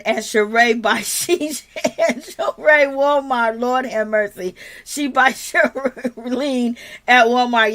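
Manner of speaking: words stretched out by laughter; real giggles breaking up the sentence; laughing while talking. Real, not acted. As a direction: try to get the names out through laughs. Cracks up while naming Sheree.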